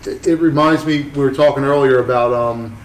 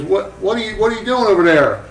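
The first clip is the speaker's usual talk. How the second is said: higher, prying question; imitating Mike Malone; 7 semitones higher than usual. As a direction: call out across the booth. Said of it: "he goes".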